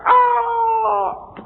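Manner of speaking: loud, very high demonic shriek; imitated scream. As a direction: mimic a possessed person's piercing scream. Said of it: "dia teriak". (tiruan jeritan melengking orang kerasukan)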